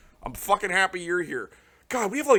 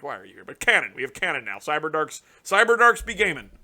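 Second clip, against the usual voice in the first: ordinary talk, nothing marked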